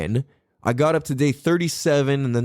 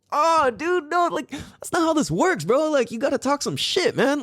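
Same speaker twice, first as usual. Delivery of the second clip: much higher, laughing protest; mock exasperation; 13 semitones higher than usual. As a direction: protest playfully that he's too nice. Exasperated at son's kindness.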